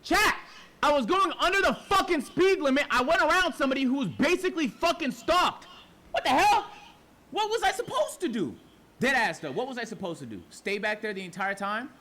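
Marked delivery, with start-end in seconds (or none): 0.0-8.6 higher and louder, exasperated venting; ranting to his stream about the unfair ticket, outraged. Real, not acted. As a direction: let the frustration burst out at chat.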